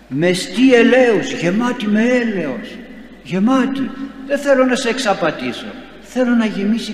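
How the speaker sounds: fervent sermon crescendo; impassioned preaching. Acted. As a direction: pour it out with fervour. Preacher exalts mercy.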